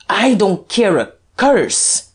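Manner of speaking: loud, high, drawn-out outburst; defiant cry. A narrator's reading. Guerchard defying the Duke, loud high cry. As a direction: shout it slowly with defiance. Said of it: "cried Guerchard".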